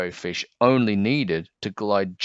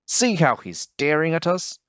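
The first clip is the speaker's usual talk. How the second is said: higher, grumbling little fish; irritable high fish voice; 8 semitones higher than usual. Acted. Jagged-fin fish grumbling to the others, irritable and higher. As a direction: sound annoyed and suspicious, pitched up. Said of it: "he went on irritably".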